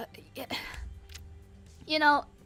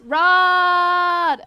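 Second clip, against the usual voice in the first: loud, high call across the room; calling a hiding friend by name, loudly; 7 semitones higher, 12 dB louder than usual. Real, not acted. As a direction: shout his name to bring him out.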